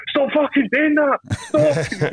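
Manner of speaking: high, slow panicked shriek; yelling in a fish panic; mock panicked yell. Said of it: "I'm like".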